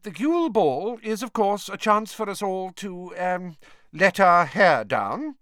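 high, prim teacher voice, slowing at the end; disapproving. A narrator's reading. Professor grudgingly allowing the class some fun, disapproving. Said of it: "said in a disapproving voice".

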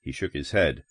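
usual speaking voice

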